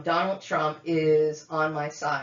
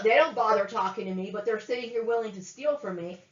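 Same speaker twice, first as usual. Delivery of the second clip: ordinary delivery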